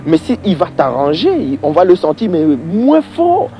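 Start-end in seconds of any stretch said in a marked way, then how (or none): none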